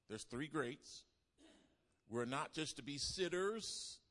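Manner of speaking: loud, rising sermon call; full-force preaching. Real, not acted. Preacher calling the church to the three greats, loud rising. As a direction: build it up and hammer the point.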